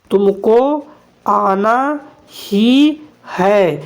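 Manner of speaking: slow, drawn out, leaning on ही; emphasis on ही. Shown as a demonstration. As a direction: stretch it and press on ही. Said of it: "ये क्या कर रहे हैं? जोर दे रहा है". (ही पर ज़ोर देकर धीरे बोलें)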